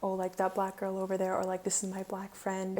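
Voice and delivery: ordinary talk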